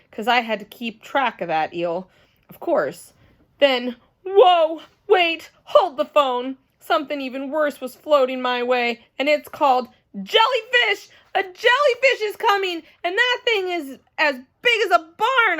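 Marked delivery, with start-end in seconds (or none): 3.6-15.6 high, loud alarmed cries. Acted. Junie B spotting a huge jellyfish, alarmed. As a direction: sound the alarm.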